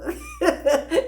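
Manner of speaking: high burst of giggles; quick amused cackle; real laugh. Real, not acted. Laughs at own question.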